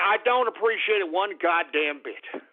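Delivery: normal talking voice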